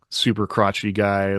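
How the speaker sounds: ordinary talk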